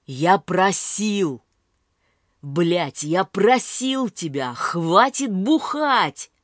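loud, slow furious shout; angry yell. A narrator's reading. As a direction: yell at a drinking mother. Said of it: "Ору на мать". (злой крик на мать)

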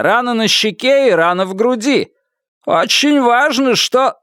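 high, urgent voice through static; over the radio. A narrator's reading. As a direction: push the words through a crackly radio. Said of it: "Голос его был невыразительным и далёким".

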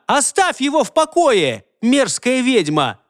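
high, indignant outburst; yelling. A narrator's reading. Girl defending Holger from the witch, yelling. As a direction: lash out in a high, angry voice. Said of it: "закричала она".

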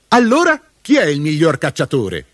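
high, cocky demand; boastful challenge. A narrator's reading. Mudube asks grandfather who hunts best, boastful challenge. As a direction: throw it out triumphantly, pitched high.

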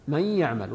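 "من يعمل" said as may-ya'mal with doubled y; no separate n, "من" flows into the ya of "يعمل"; merged noon. Shown as a demonstration. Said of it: "تدغم النون في الياء فنقرأها".

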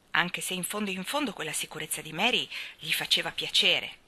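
ordinary delivery, nothing marked

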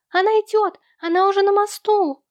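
put-on very high-pitched urgent child voice; high, excited call of warning; child's warning cry. A narrator's reading. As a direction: cry out the alarm in a high little-boy voice. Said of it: "вбежал в комнату с криком".

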